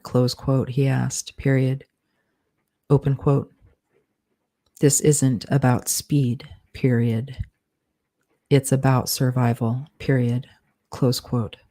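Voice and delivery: steady dictation with spoken punctuation; dictating. Acted. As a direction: read it out like dictating a draft. Author dictates novel with punctuation.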